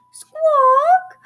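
very high, loud parrot screech; piercing bird cry; parrot squawk. A narrator's reading. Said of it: "she squawked".

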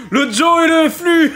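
giggling through the words; laughing. Real, not acted. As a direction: laugh while saying it. Mangling the name, laughing.

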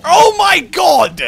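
high, drawn-out cry; exasperated shout. Real, not acted. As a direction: wail it loudly in frustration. Losing ground in game.

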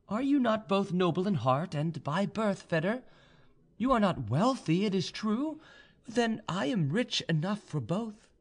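high-pitched, questioning heroine; young noblewoman. A narrator's reading. Proud young woman questions her suitor's apology, high-pitched. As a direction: voice her high, gently challenging him.

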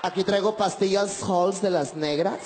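slow, lightly voiced old lady offering candy; a hint of a kindly seatmate; woman beside him offering cough drops, slow; lady passenger. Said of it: "Una señora que venía a un lado mío me dijo".

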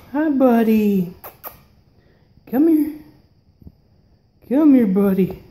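normal talking voice

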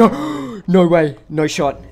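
loud gasping disbelief; gasp, can't believe it; shocked disbelief. Real, not acted. Nearly tied world record.

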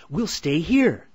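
loud, high, decided; firm declaration. A narrator's reading. Hansel deciding to stay, loud and sure. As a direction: announce it like the boss. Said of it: "Hansel declared".

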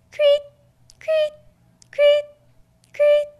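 very high short creet chirps; cricket chirps. A narrator's reading. Reading to a child, crickets chirping high in the grass. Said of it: "The crickets go".

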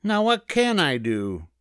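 high-pitched rabbit wondering; puzzled little rabbit character; pondering rabbit. A narrator's reading. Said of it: "thought the rabbit".

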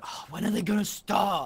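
imitating impatient viewer. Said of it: "Impulse going".

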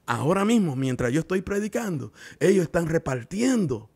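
rising pulpit fervor; fiery preaching. Acted. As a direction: preach it hard to the congregation. Preaching builds to full force.

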